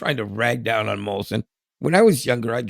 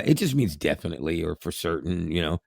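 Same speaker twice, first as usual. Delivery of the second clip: ordinary talk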